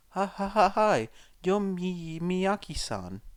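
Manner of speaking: slow, high, stuttering question; nervous laugh then halting words; anxious boy stumbling through a greeting; nervous stammer. A narrator's reading. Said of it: "Izuku asked, still nervous".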